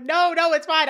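imitated urgent reassurance; hurried mock reassurance. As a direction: cut in to calm a panic. Jack hurriedly reassures panicking Eric.

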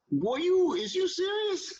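incredulous disbelief. Retold or imitated. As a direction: retell his shock with a sky-high pitch. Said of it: "I was like".

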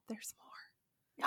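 whispered, ominous aside; hushed teaser. Real, not acted. Storyteller hinting the evil king's tale continues, whispered. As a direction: drop to a whisper for the twist.

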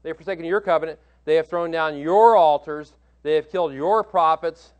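each your hit loud and firm; stress on your. Shown as a demonstration. Preacher stressing your in Elijah's words to God. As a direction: punch every your in the list. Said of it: "And these are emphasized".

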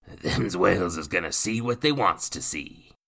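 rustic coachman calling out; gruff coachman. A narrator's reading. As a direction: call out like a rough coachman. Coachman voiced.

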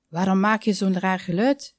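ordinary delivery

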